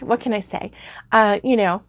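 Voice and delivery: ordinary delivery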